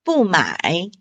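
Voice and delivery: 不买 with 不 falling, 买 dipping; bù mǎi demo. Shown as a demonstration. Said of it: "买三声".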